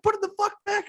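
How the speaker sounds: very high, squawking mock order; imitated yelling. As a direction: scold like a manic game character. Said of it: "He's fucking yelling at you".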